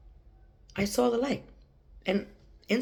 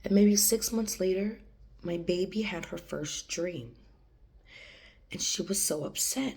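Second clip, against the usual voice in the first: ordinary delivery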